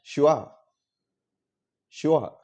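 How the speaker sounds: షువా said as two syllables, shu-waa, falling; teacher carefully modelling షువా; model pronunciation. Shown as a demonstration. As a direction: sound out షువా with an open "waa" ending. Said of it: "దీన్ని ఎలా పలకాలి అంటే".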